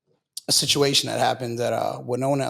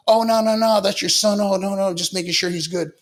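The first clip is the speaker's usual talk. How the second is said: backing-down inmate; 6 semitones higher than usual. Retold or imitated. Inmate backing down once the jacked dad claims his son, higher nervous backpedal. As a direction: play the inmate suddenly eager to smooth it over.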